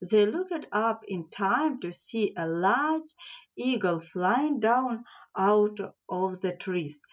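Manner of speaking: usual speaking voice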